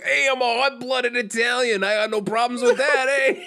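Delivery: high, loud mock Italian tough-guy accent; Italian accent impression. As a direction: ham up a hot-blooded Italian accent. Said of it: "God, that's so Italian".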